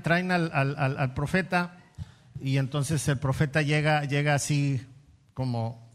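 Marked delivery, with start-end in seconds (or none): none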